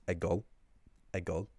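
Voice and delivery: teaching the Ulster word for going; Ulster Irish word. Shown as a demonstration. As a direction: give a goal the Ulster sound. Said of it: "in his Ulster pronunciation".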